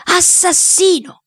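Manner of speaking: slow, high-pitched snarl; drawn-out furious accusation. A narrator's reading. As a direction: snarl it syllable by syllable.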